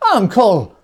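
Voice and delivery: high, loud pleading cry. A narrator's reading. Nephew pleading with his uncle, high and loud. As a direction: beg him with one word. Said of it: "pleaded the nephew".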